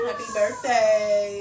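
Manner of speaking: loud, drawn-out birthday cheer; belted happy-birthday holler; birthday shout-out. Real, not acted. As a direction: call it out big and slow.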